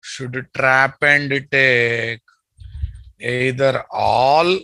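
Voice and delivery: each word slow and deliberate; slow dictation. Shown as a demonstration. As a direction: spell it out slowly for notes. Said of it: "teacher dictating slowly".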